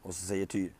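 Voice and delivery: ordinary delivery, nothing marked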